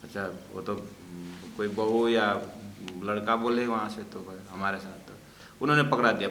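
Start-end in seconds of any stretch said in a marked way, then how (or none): none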